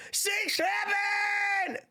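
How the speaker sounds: drawn-out high yell of six seven; meme shout. Pretend. Streamer yelling the meme to chat, drawn-out high shout. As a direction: belt the meme slowly and high.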